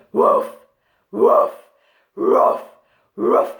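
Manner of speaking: loud drawn-out woof; long bark. Shown as a demonstration. Showing the longer bark version, drawn-out woof. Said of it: "make it go for a bit longer".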